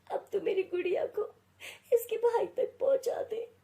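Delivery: high, tearful lament rising into sobs; a wronged mother's spirit weeping out her vow; grieving ghost mother. Acted. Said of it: "रोते हुए रूपा की आत्मा".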